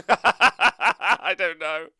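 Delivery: laughing through the words; slow, giggling answer. Real, not acted. Laughing at a chat question.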